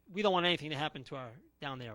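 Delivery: usual speaking voice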